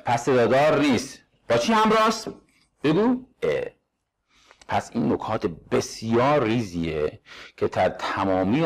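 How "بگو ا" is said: ordinary talk, nothing marked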